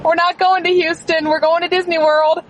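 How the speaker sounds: high, loud, joyful announcement; excited surprise reveal. Real, not acted. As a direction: burst out the big news. Dad reveals Disney on video.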